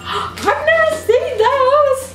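high, slow amazed gasp; excited gasp. Real, not acted. Seeing new toys for the first time, high amazed gasp. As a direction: marvel at something new.

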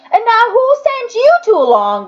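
mocking woman. A narrator's reading. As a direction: laugh at the two small boys. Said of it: "the women laughed".

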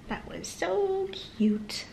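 high, slow gushing delight. Real, not acted. Mother to her child after the book, slow high delight. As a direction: gush over how sweet it was.

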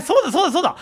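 high, loud, laughing realization; excited laughing. Real, not acted. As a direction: burst out laughing as it clicks. Excited realization while gaming, laughing.

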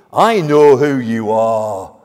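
slow, loud drawn-out cry; demon's loud recognition. Acted. Demon facing Jesus's authority, loud awed cry. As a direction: wail it out in awed certainty. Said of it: "this demon is, is trembling here".

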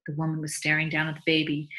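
ordinary delivery, nothing marked

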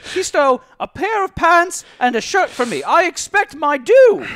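high, loud indignant woman voice; indignant merchant woman. Acted. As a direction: demand it in a high shrill lady voice. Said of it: "She's like".

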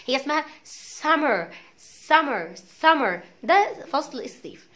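سمر with a curled American r, tongue never touching; American summer. Shown as a demonstration. Teacher drilling the class on سمر. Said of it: "ما تقولش سمر".

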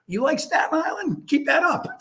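mock warning. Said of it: "He goes".

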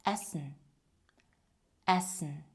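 Есен with one hissed, unvoiced s; German essen with a single s sound; voiceless S. Shown as a demonstration. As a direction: keep the s in Есен sharp and voiceless. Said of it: "глухий звук С".

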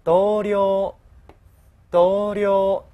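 同僚 read level and loud; flat zero tone. Shown as a demonstration. Teacher modelling zero-tone 同僚 for the class. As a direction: say 同僚 evenly, no pitch drop. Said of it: "零调".

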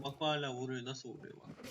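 usual speaking voice

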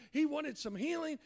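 ordinary talk, nothing marked

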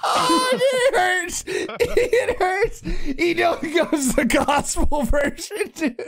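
high, squeaky words through helpless laughter; laughing till tears. Real, not acted. Streamer undone by gospel song, laughing till it hurts. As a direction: barely get it out while cracking up. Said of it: "I'm in tears".

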